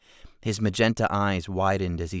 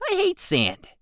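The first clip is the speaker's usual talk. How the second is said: goblin rant; 4 dB louder than usual. Acted.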